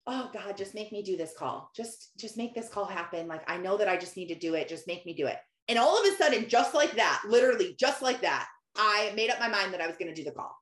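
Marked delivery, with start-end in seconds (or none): 0.1-5.4 soft, lowered retold prayer; under the breath. Mother praying while making her daughter breakfast, under her breath. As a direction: pray quietly, as if only to yourself. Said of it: "kind of under my breath".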